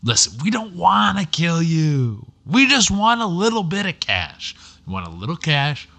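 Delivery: calm the victim down like the nice robber; good cop. Pretend. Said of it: "I'll do good cop".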